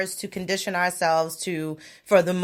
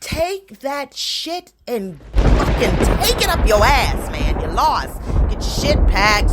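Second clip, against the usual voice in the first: angry rant; 4 semitones higher, 6 dB louder than usual. Real, not acted. Ranting at Trump camp.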